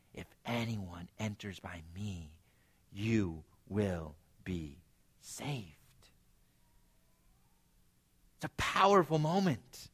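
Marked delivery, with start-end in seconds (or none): 0.1-6.1 hushed intimate appeal. Acted. Voicing Jesus' direct appeal.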